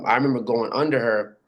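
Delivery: normal talking voice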